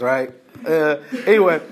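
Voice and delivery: ordinary talk, nothing marked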